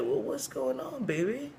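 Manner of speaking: high, cracked elder's voice; cracked-voiced church mother. Church mother asking the crying woman what's wrong, cracked voice. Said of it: "she has a really cracked voice".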